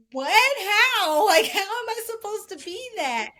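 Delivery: high, loud "How?" breaking into a laugh; squeaky stunned "How?" that melts into a laugh; incredulous disbelief. Retold or imitated. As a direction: caught off guard and baffled, laughing at the idea. Retold disbelief at God's call.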